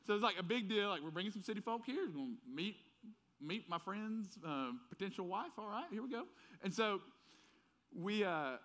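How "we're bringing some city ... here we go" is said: a hint of a rural Southern buddy teasing; country friend. Old school friend teasing about city folk, mild Southern country voice. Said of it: "really country high school friends was like".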